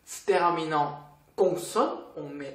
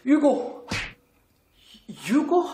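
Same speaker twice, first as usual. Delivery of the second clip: mock surprise; 8 semitones higher, 3 dB louder than usual. Playing a confused interlocutor.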